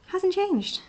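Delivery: squeaky high exasperation; high-pitched exasperated. Real, not acted. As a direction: pitch it way up, annoyed. Repeated high remark.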